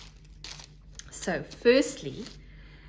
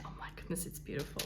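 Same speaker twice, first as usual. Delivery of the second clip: whispering; 5 semitones lower, 14 dB quieter, much faster than usual. Real, not acted. Hushed awe over fiber.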